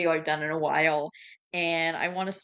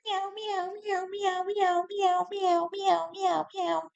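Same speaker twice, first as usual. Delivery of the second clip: high-pitched run of mimicked meows; repeated cat meows; 13 semitones higher, much slower than usual. Cat pestering its baffled owner, high repeated meows. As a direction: mimic an insistent cat, meow after meow. Imitates the cat's endless meowing.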